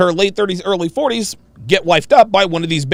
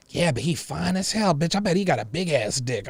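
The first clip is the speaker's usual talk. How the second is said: mock woman; 9 dB quieter than usual. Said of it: "voicing a woman".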